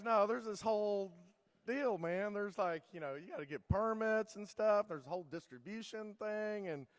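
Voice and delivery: a lightly voiced liquor guy laying down the rules; exasperated store owner. Liquor store guy explaining permits.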